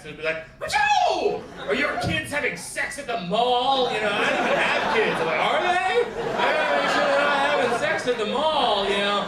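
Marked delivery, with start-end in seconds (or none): 0.6-1.4 very high, loud laser zap; laser sound effect. Retold or imitated. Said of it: "like, the laser sound effects". 1.7-3.9 loud, high, slow mock headline; alarmist news anchor. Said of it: "treat every story with the same level". 5.4-8.8 loud, high alarmed reaction; mock panic. Panicked viewer reacts to headline.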